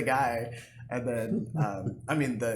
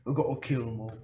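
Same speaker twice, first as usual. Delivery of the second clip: mock British accent. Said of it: "He's doing this impression because we watched a streamer that had an accent".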